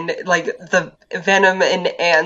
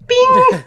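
high, loud ding; elevator ding imitation; 6 dB louder than usual. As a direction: chime like opening elevator doors. Imitates elevator doors opening.

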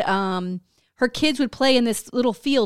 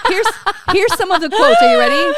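laughing through the setup, higher and louder; 11 semitones higher, 7 dB louder than usual. Real, not acted. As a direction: giggle while you tease the quotes. Laughing while introducing the quotes.

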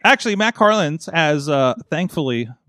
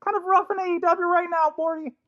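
put-on much higher, stammering nervous teen voice; Morty voice; 14 semitones higher than usual. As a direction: stutter it out in a jittery high pitch. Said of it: "your Morty voice sucks".

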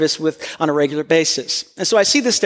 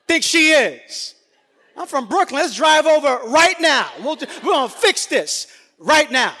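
high, laughing mock tough-guy shout; husband playing a hothead ready to drive over; hammed-up Brooklyn bluster; 8 semitones higher than usual. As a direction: puff up like an angry Brooklyn guy. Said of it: "disproportionate way".

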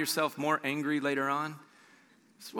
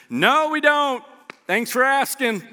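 sarcastic reply; 9 semitones higher, 6 dB louder, slower than usual. Pretend. Sarcastic disciples answering stranger.

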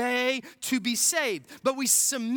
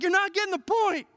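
exasperated Jesus; 10 semitones higher, 6 dB louder than usual. Retold or imitated. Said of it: "And Jesus is just like".

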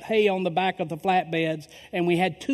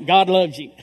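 loud burst of God loves you; preaching shout; 11 dB louder than usual. Real, not acted. Preacher shouts to the crowd, loud and full. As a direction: fire it out at the congregation. Preacher's sudden aside to the congregation.

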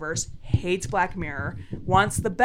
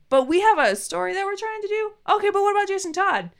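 much higher, protesting back and forth; acted exasperated argument; 11 semitones higher, faster than usual. Viewer arguing with writers who keep straying, high mock exasperation. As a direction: plead high, then butt in insistently.

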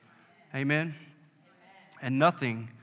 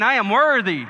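much higher and louder, repeated; call-and-response shout; 7 semitones higher, 8 dB louder than usual. Shown as a demonstration. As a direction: call it out again for all to join. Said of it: "Say it again".

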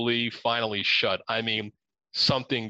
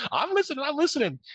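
nervous owner; faster than usual. Retold or imitated.

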